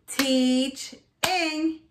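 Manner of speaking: "Teach-ing" with a gap between teach and ing; chopped into syllables. Shown as a demonstration. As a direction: chop "Teach-ing" into two beats. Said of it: "chop it out".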